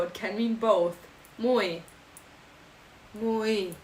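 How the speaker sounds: usual speaking voice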